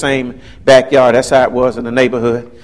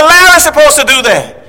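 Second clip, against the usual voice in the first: put-on child's protest; 11 semitones higher, 6 dB louder than usual. Child protesting to great-grandmother.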